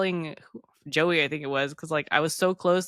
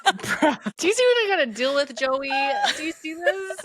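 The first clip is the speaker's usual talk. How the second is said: high, laughing, pleading; laughing complaint; 8 semitones higher than usual. Real, not acted.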